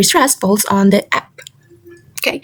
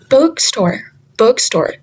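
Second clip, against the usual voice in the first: bookstore with book stressed; slower than usual. Shown as a demonstration. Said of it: "the stress is on the word book".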